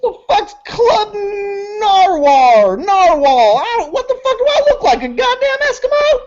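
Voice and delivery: high screech, speeding up; crazed high-pitched ranting; indignant crackhead. Acted. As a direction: screech it, baffled and outraged. Game master playing the crackhead.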